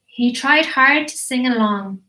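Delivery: plain reading voice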